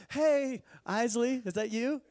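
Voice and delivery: a father's hammed-up frightened call to his daughter; scared high question. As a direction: voice cracking with fright. Scared father checking the figure.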